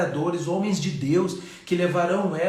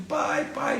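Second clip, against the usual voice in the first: put-on high pleading "Pai, pai" like a sobbing girl; a daughter's tearful, high call to her father; imitating crying daughter; 9 semitones higher than usual. Pastor imitates weeping daughter calling father, high tearful plea. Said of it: "assim, chorando muito".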